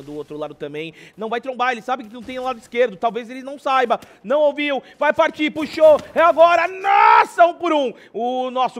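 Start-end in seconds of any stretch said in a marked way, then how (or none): 4.2-7.9 building from calm to shouted call; rising excitement. Real, not acted. Live call of a clutch play.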